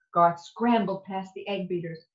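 plain reading voice

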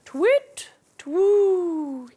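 high, loud hooting owl. Acted. Owl calling the children to fly. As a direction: hoot it out like a big owl. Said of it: "he says".

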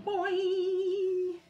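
high, loud, wobbling boing; long sung spring noise; cartoon boing. A narrator's reading. Fish bounces off the tree, high wobbly boing. Sound effect in picture book.

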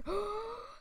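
high, sharp gasp; sudden dramatic intake of breath; mock-horrified gasp. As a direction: gasp in pretend alarm. Sudden alarm at red helicopter.

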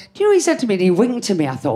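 ordinary talk, nothing marked

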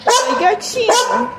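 slow, high, stretched coo at the kitten; drawn-out sing-song greeting to a kitten. Real, not acted. Cooing at a kitten.

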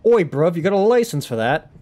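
cheeky British street challenge; British accent. Acted. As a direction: challenge them in slangy mock British. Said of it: "British accent request".

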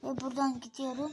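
ordinary talk, nothing marked

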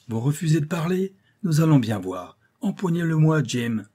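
threaten, then order your man to seize him; captain losing patience with a captive; menacing order. A narrator's reading. Captain threatens the silent uncle.

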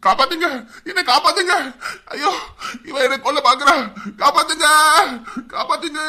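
high, loud panicked pleading; terrified cries for help. Acted. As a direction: scream for help, half laughing in fear. Victim screaming for help. (பயத்தில் உயர்ந்த குரலில் கதறல்)

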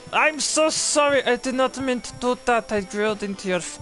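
high, loud mock-panicked apology in German accent. Surgeon who drilled into patient by mistake, mock panic. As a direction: blurt out a frantic sorry, hammed-up German accent.

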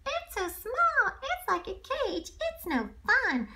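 very high, slow complaining squeak; high, slow cartoon-animal complaint; whiny little animal. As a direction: whine like a tiny animal stuck in a cage. Child voices unhappy zoo animal.

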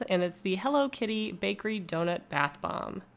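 ordinary talk, nothing marked

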